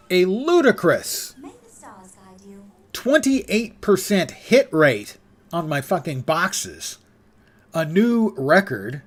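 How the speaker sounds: slow, loud, mock-proud; sarcastic mock pride. Real, not acted. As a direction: drag it out as a sarcastic boast. Sarcastic brag about a bad hit rate.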